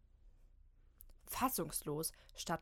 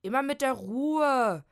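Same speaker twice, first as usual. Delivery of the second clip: loud, slow, higher booming call; rumbling from inside; 6 semitones higher, 13 dB louder, slower than usual. Acted. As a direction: bellow back from the bathroom. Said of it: "poltert es von drinnen". (laut polternd rufen)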